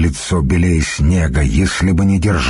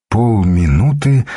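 plain reading voice

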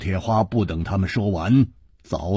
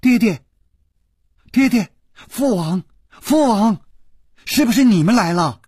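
high, urgent cries; calling loudly; 6 dB louder than usual. A narrator's reading. Princess runs toward the tent calling her father, high and loud. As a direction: call out eagerly in short high bursts. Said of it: "大声叫着".

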